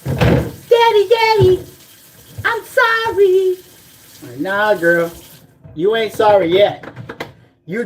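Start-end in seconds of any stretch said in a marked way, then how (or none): 0.0-3.6 high, whiny mock begging; mocking ex's plea. Ex texting out of the blue, mocked as high whiny begging. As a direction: imitate her pleading, pitched way up. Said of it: "She hits me up ... talking about".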